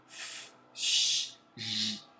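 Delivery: hissed f, sh and j held out; fricative sounds. Shown as a demonstration. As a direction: voice the fricatives f, sh, j as demonstrations. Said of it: "É um bloqueio parcial". (sons fricativos f, ch, j demonstrados)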